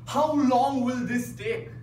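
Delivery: ordinary delivery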